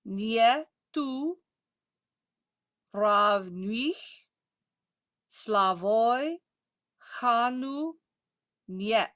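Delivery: very slow, drawn-out Russian words; slow pronunciation model. Shown as a demonstration. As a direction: space out each word for repetition. Models last line word by word.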